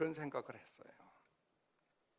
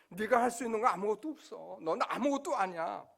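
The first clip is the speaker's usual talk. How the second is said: stern rebuke; 5 semitones higher, 8 dB louder than usual. Retold or imitated. God humbling him, voiced.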